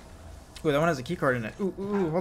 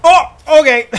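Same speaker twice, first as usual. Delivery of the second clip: surprised laugh; 12 semitones higher, 14 dB louder than usual. Real, not acted. Shocked reaction then laughter.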